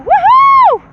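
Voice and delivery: a high, loud joyful scream. Retold or imitated. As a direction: let the frustration out in one big whoop. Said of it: "so let me just scream".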